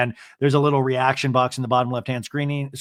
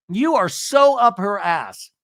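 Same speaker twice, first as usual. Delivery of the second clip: higher, slow, pointed mock scolding; retold confrontation, pitched up and emphatic; acted accusation; 5 semitones higher, 4 dB louder, slower than usual. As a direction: stretch it out as an imitated jab.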